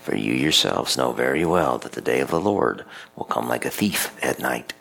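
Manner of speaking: measured, solemn scripture reading; steady lector voice, each line laid out; liturgical reading. Acted. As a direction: proclaim the lectionary text at Mass. Priest reads scripture at Mass.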